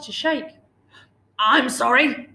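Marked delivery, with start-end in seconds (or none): 1.4-2.3 loud, flustered dragon apology; startled storybook apology; spluttering. A narrator's reading. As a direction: splutter it out in mild panic. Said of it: "he spluttered".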